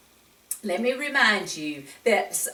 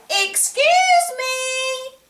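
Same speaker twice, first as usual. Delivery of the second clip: put-on high, sweet little-boy "Excuse me"; child voice; 9 dB louder, slower than usual. Reader voicing David's polite excuse me. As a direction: squeak it out like a small polite boy.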